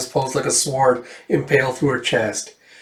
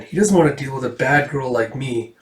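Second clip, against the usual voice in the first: normal talking voice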